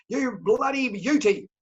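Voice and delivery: drawn-out cheer to your lovely wife; delighted Aussie exclamation. Acted. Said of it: "and you'll say".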